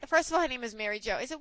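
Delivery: usual speaking voice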